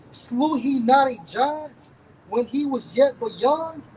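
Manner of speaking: scripture reading. Real, not acted. Reader proclaims David's deeds from Ecclesiastes to back up teacher, scripture reading. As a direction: read the verse out with measured, ringing weight.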